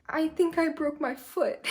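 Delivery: high, small voice about to cry; mock breaking down. Gymnast tells teammate about the broken foot, mock breaking down. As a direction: act out tearful confession in a high pitch. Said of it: "that's when I start to break".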